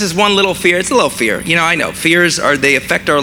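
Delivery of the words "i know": ordinary delivery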